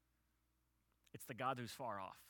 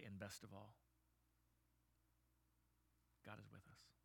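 soft, low, fading last words; dying words; 4 semitones lower, 20 dB quieter than usual. Retold or imitated. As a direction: say it hushed, with long pauses. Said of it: "with his dying words".

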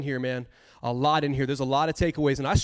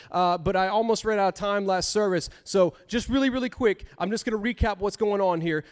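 ordinary delivery

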